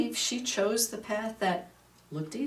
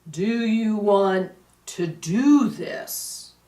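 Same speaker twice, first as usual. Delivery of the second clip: slow, louder, drawn-out solemn question; 9 dB louder, much slower than usual. Retold or imitated. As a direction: ask it slowly, like a voice from beyond.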